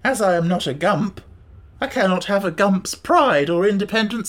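mournfully formal talking beast; resigned Gump. Acted. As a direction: lament your lot theatrically.

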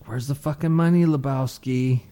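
high, playful film quote; mock movie-thug demand. Quoting the movie while sending a video, mock demand.

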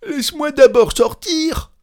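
very high, loud begging; panicked cry. A narrator's reading. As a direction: shriek to be let out of the chest. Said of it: "s'écria le chantre dans le coffre". (supplication criée, très aiguë)

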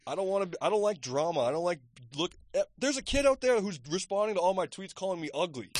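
half-laughing indignant rant; worked-up rant. Real, not acted. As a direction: get heated about the trolls. Venting about mean tweets, animated.

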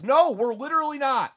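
high, loud, exasperated contradiction; emphatic retort. Real, not acted. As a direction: cut in sharply to shoot the claim down. Rebutting the voiced media pundit.

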